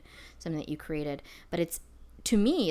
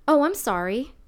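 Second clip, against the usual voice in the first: higher, louder automatic "sorry"; quick-reflex apology, voice raised; reflexive apology; 7 semitones higher, 9 dB louder than usual. Retold or imitated. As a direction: blurt out a polite apology, pitched up. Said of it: "I just reflexively said".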